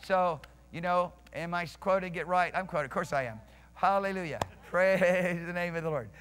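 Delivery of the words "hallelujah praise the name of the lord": real chuckle leading into praise; laughing praise. Real, not acted. Preacher praising the Lord before the congregation, laughing. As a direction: let a laugh spill into the praise.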